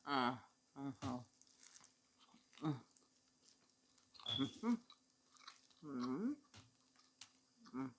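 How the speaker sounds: chewing with mmms; eating hungrily, mouth full; wolfing down food. Real, not acted. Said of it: "I gotta dive right in".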